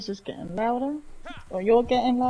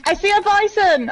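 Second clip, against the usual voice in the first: higher and louder, calling out the find; excited call; 8 semitones higher, 9 dB louder than usual. Real, not acted. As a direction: shout it out like you just spotted prey. Spots a bison while hunting.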